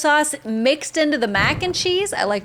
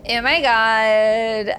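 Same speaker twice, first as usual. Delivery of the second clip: put-on Valley Girl voice; 4 dB louder, much slower than usual. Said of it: "thank you for that Valley Girl voice".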